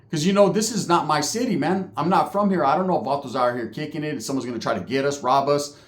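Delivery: ordinary talk, nothing marked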